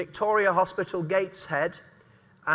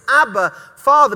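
Abba, Father called out louder; fervent proclaiming; 4 semitones higher, 10 dB louder than usual. Acted. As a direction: ring it out as a cry to the Father. Preacher proclaims the cry again.

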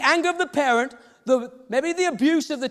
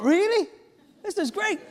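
high-pitched delighted surprise; excited; 7 semitones higher than usual. Retold or imitated. Father thinking about his son's vision from God, excited. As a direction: react with lifted, eager wonder.

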